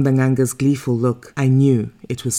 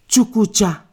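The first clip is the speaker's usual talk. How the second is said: high ringing cry over the bones; sharp call; 8 semitones higher than usual. A narrator's reading. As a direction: call the word out as a ritual command. Healer has her cast bones.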